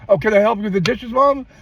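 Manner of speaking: put-on high, eager kid offering to help; a naive child taking her word literally, voiced high; innocent little boy. A son offering his mom help with the dishes, high and eager.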